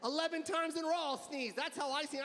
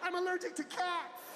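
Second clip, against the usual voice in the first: panicked protest; 7 semitones higher than usual. Retold or imitated. A shopper recoiling from a sneezer, high frantic shout.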